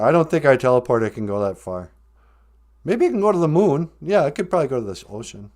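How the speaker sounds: normal talking voice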